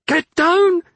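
high, loud scream of warning. Acted. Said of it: "she screamed at him".

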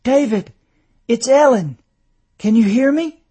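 high, loud calls to rouse him; urgent calling out. A narrator's reading. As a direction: call to someone barely conscious. Wife rousing dazed husband.

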